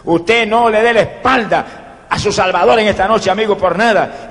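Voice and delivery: ordinary delivery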